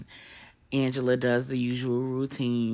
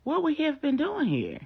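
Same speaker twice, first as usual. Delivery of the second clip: much higher suspicious question; puzzled; 4 dB louder than usual. Acted. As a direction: ask it high, puzzled.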